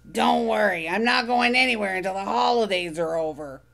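Oscar grumbling. Acted. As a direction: grumble it from the trash can. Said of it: "grumbled Oscar".

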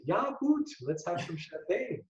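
put-on high, lilting Swiss accent. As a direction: put on a light-hearted Swiss accent, pitched well up. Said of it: "he'd say in a Swiss accent".

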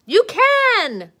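high, loud insistent push; self pep shout. Acted. Caterpillar shouts encouragement at himself in the mirror.

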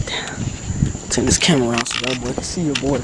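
usual speaking voice

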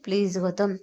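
plain reading voice

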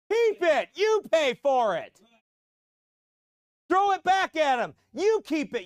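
high, loud scornful rant; loud sarcastic tirade; mocking shout. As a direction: shout down a coward preacher. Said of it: "I'm like".

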